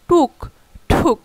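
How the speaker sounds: টুক plain, then ঠুক with a strong breath, slow; slow pair, extra air on ঠুক; aspiration contrast. Shown as a demonstration. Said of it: "দ্বিতীয় শব্দে কিন্তু বাতাসটা বেশি".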